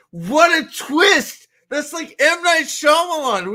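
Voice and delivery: high, drawn-out hammed-up reveal; mock amazement. Podcaster capping his invented movie ending, mock amazement.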